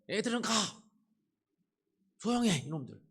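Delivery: loud, high barked orders to leave and shut up; angry shout. Retold or imitated. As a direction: shout the kids down, fed up. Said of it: "막 이러면서".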